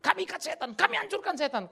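high, loud mock declaration; hammed-up spiritual warfare shout; mocking bold binding. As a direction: parody a proud prayer warrior. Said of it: "Kalau kau pikir".

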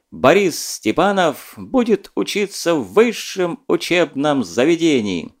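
slow, solemn radio announcer, mock grand; booming solemn state announcer. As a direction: playfully imitate a Soviet radio announcer. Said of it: "подражая диктору". (шутливо, торжественно, как диктор Левитан)

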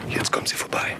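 breathless unvoiced whisper. Reporter hides as three men pass, whispering. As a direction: hold your breath as they pass.

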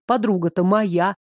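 plain reading voice

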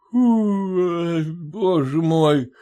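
put-on very slow sleepy drunk mumble; barely mumbling, nodding off; drowsy mumble. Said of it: "еле бормочет он".

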